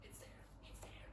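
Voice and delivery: hushed, breathless whisper; awed whisper at spotting the tower far off; excited whisper. Retold or imitated. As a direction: whisper to a friend so as not to break the spell. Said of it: "I was like".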